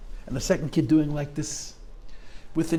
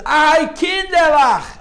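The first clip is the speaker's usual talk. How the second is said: anguished cry; 10 semitones higher, 9 dB louder, much slower than usual. Retold or imitated. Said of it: "and he says".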